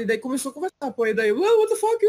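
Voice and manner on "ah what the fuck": surpresa imitada. Retold or imitated.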